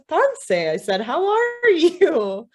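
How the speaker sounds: high crow squawk, drawn out at the end. Acted. Crow repeating its hello to the woman, high insistent squawk.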